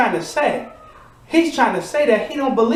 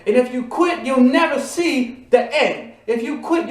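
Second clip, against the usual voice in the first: normal talking voice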